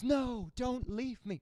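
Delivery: slow, high tragic plea; hammed-up melodrama; melodramatic soap plea. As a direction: beg like a soap heroine. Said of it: "so melodramatic".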